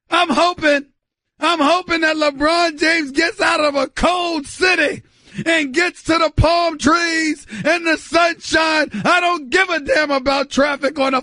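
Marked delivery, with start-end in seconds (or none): none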